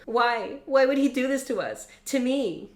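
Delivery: high, anguished pleading. Acted. Blakely asks why in anguish.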